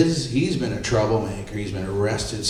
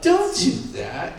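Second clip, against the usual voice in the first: higher retold protest; indignant protest; 7 semitones higher than usual. Photographer to the man who bit him, indignant protest. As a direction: act out pushing off an attacker. Retold reaction to being bitten.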